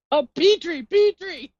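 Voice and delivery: high, loud, drawn-out mock cry; cartoon call. Teasing a Land Before Time pick, high mimicking call. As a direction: call the name out high and stretched.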